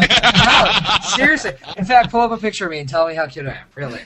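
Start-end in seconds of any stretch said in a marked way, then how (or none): 0.0-1.5 laughing through a mock protest. Real, not acted. Laughing off the host's compliment, giggling protest. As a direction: giggle out the denial.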